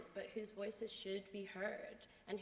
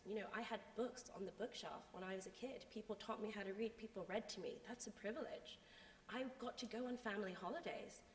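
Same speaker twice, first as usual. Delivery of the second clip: ordinary talk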